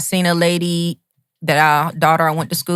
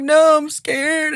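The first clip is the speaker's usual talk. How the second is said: retold panic, higher and slow; scared; 10 semitones higher, much slower than usual. Telling pre-op staff his fear, slow high panicked plea. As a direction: drag it out like a frightened plea. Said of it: "I'm panicking".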